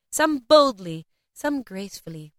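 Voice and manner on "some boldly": higher, louder, confident punch; brash, forthright narration; bold. A narrator's reading. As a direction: announce it boldly. Narrator acts out the word boldly.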